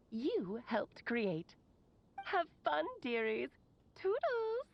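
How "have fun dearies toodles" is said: sing-song farewell, pitched much higher; playful goodbye. Acted. A mage signing off her recording to the children, chirpy. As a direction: toss it off with a chirpy flourish.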